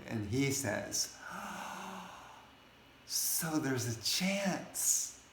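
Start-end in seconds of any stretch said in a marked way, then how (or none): 1.2-2.4 long, high, soft gasp; awed gasp. Acted. Suitor hearing his odds, awed gasp. Acting out the hopeful suitor's reply. 3.0-5.2 high, slow, wondering delivery; hopeful awe. Acted. Rejected suitor answering his date, hopeful awe.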